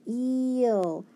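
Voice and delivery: EEL held long on the E; long E sound. Shown as a demonstration. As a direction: stretch the vowel of "eel". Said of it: "making that long E sound".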